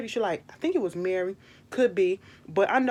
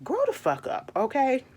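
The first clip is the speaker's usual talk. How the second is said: exasperated telling-off; 7 semitones higher than usual. Retold or imitated. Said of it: "I said".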